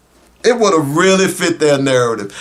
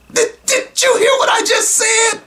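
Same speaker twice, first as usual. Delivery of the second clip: incredulous shout. Real, not acted. Host shouting his disbelief at the viewers. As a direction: yell it in disbelief, way up high. Host outraged, asks audience.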